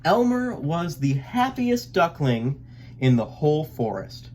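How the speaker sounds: plain reading voice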